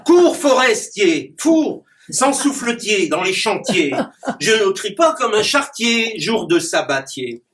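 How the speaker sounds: declaimed rhyming verse with punchy rhyme endings; poem recitation. Acted. Clown reciting his rhyming poem to the audience. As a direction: perform the poem for the audience, landing each rhyme.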